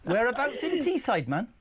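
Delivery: hammed-up Geordie-style Teesside accent. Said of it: "That's my full-on Teesside accent".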